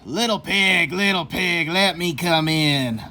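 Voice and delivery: a lightly sweet sly coyote calling; a hint of honeyed coyote. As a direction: coax the pig to open up. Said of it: "He called out sweetly".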